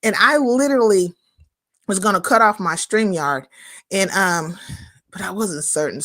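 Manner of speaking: normal talking voice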